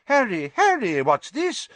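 high, loud flustered voice; agitated. A narrator's reading. Minister to boy out of bed, agitated. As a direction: fluster at the sudden commotion. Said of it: "looking agitated".

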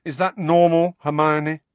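pointed question in exasperation; irritable. A narrator's reading. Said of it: "said Ron irritably".

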